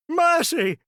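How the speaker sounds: high, loud terrified cry; begging for mercy. Acted. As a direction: throw yourself on his mercy. Scrooge falls on his knees.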